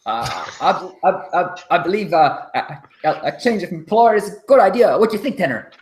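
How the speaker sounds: high, jittery, stammering captive; nervous stammering. Acted. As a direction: stammer it out, scared for your life. Captive NPC pleading for life.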